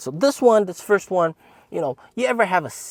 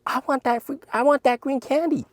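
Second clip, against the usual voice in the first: put-on very high childish whine; whiny little brother. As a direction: beg like a small kid. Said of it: "my brother's like".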